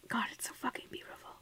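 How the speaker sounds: ordinary talk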